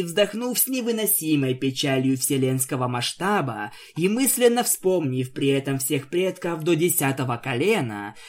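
ordinary delivery, nothing marked

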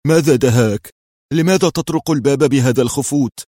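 loud, startled questioning; exclaiming. A narrator's reading. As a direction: call out in puzzlement. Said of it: "فهتف به".

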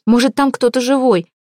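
pleading to go back; anxious plea. Acted. Lada begs to go back.

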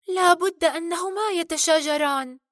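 mildly sad, high-pitched heroine. Acted. Merida sees her father facing the king, sad. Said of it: "فقالت بصوت حزين".

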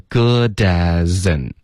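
"good as in" slow, run together as goo-da-zin; slow, joined-up "good as in"; linked speech. Shown as a demonstration. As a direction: carry the d and z across in "good as in". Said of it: "这里都连读了".

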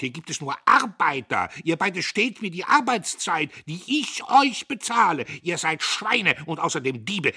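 high, loud outraged tirade; furious railing. A narrator's reading. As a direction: shout down both culprits, building in outrage. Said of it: "Er zeterte".

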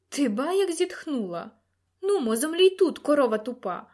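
jeering, pitch jumping up; mock the fainting lady contemptuously; scornful taunt. A narrator's reading. Lord scornfully taunts frightened lady. (зневажливе кепкування)

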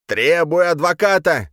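high, loud, drawn-out squeal; indignant squeal; squealed demand. A narrator's reading. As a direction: shriek the demand slowly and high. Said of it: "взвизгнул Лжедмитрий".

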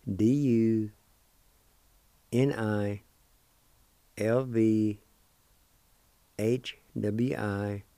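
slow letter-by-letter spelling. Shown as a demonstration. Teacher spelling a word letter by letter. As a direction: dictate each letter with pauses for the learners. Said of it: "And it's spelled like this".

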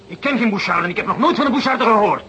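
high, loud, frantic shouting; furious denial. Acted. Banker denies the charge to the inspector's face, furious shouting. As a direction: panic into rage, grabbing his lapels.

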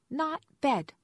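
put-on high, loud little-girl protest; small child holding out against bedtime; bargaining toddler. A narrator's reading. Toddler still negotiating over bedtime, insistent child voice. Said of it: "Aissa was still negotiating".

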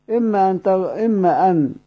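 ordinary talk, nothing marked

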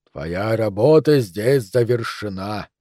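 slow, low-voiced villain impression; Darth Vader. Doctor tells the retiring captain his work is done, Darth Vader voice. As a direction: intone it slowly and weightily in a low voice. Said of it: "низким голосом Дарта Вейдера".

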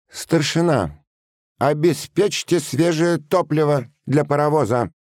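officer's high, calling command; shouted order. A narrator's reading. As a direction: call the order across the whole platform. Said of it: "громко на весь перрон прокричал подпоручик". (громко, на весь перрон)

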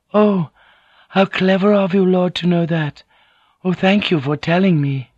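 very high, apologetic lady; submissive, wide-eyed apology; meek, flattering noblewoman. A narrator's reading. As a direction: fawn in a high feminine voice.

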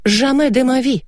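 high, theatrical French refusal; emphatic declaration. A narrator's reading. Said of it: "заявила я и удивилась".

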